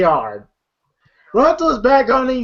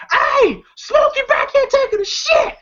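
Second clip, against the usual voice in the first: high shouted announcement; street shout. Retold or imitated. Neighbor yelling in the street, high shout. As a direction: broadcast it down the whole street.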